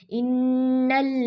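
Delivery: इन्नल्लज़ीन slow with firm doubled nun and lam; slow recitation; tajweed recitation. Shown as a demonstration. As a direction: hold the doubled letters in इन्नल्लज़ीन. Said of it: "proper अदा कीजिए".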